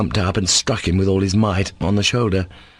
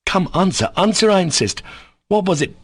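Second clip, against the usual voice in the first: high, pressing demand; insistent demand; 11 semitones higher than usual. Acted. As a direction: press him hard for the answer. Ivan insisting on an answer.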